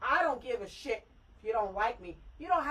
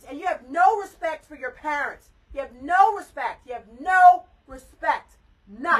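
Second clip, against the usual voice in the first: building from firm to a full furious shout; furious mom scolding her disrespectful daughter; angry shout building; 9 dB louder than usual. Real, not acted. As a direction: get louder each time until you're yelling at her.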